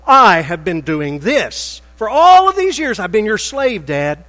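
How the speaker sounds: loud, high, resentful protest at the father; indignant accusing son. Acted. Older son shouting his grievance at his father, loud and high. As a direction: throw the years of work in his face, loud and high.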